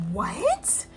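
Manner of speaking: high, rising incredulous what; retold shock, high and questioning. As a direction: act out disbelief, pitch shooting up. Retold shock at friend's accusation.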